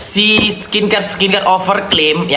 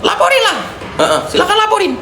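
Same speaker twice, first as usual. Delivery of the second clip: mocking taunt; faster than usual. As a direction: taunt them in a squeaky high voice. Taunting critics to report her.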